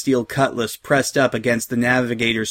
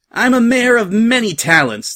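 high, loud boast to a crowd; cocky pirate calling out; called over clamor; 10 semitones higher, 5 dB louder than usual. A narrator's reading. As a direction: project it proudly over the noise. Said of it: "said over the clamor of the crowd below her".

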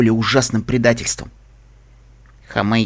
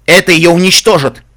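loud, high-pitched outburst; stamping outburst; 6 semitones higher, 10 dB louder than usual. A narrator's reading. Лайфблум protesting what will destroy her, loud outburst. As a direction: cry it out as if stamping a hoof. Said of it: "топнул Лайфблум".

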